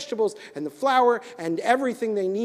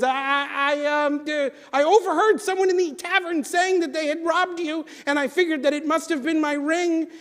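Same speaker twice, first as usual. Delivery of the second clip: high, stumbling nervous excuse; a caught liar scrambling for words; flustered stammer; 8 semitones higher than usual. Acted. Leather worker caught in his lie, high nervous stammer.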